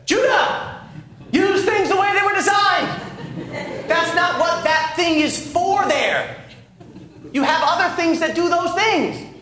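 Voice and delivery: very high, exasperated dad yell; imitated parental yelling. Preacher turned into his father, yelling at his child, re-enacted. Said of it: "constantly yelling at Judah".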